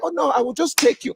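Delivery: high, lightly voiced Nigerian dad; threatening father. Father threatening to send kid away, lightly accented.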